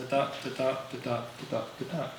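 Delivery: "da, da" in pairs, weak then strong; steady da-DA beat on "da, da"; iambic pentameter rhythm. Shown as a demonstration. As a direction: chant "da, da" five times over, stressing every second da. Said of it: "iambic pentameter".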